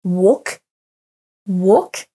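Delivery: walk with no L sound, like wɔk; walk sans le L; silent L. Shown as a demonstration. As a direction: drop the L in walk. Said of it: "le L, il est silencieux".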